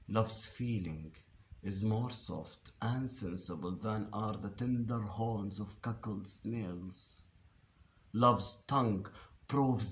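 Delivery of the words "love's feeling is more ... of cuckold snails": soft, lowered stage delivery; hushed verse. Acted. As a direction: let the verse sink to a delicate hush. Verse on love's tender touch.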